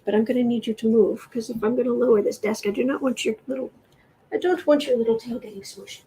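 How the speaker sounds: ordinary delivery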